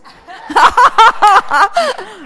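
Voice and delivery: long, loud, high peal of laughter; big belly laugh. Real, not acted. Preacher amused by husband's word on disobedience, laughing loudly. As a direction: roar with laughter.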